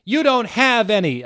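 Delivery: high, loud angry yell; yelled madly. Acted. Ebony yelling at Snape in anger. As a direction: yell it back. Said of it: "I yelled in madly".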